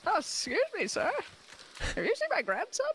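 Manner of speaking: high, frail grandma voice; doting grandparent. Pretend. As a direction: quaver like a lost grandmother. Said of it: "you're doing this a little too well".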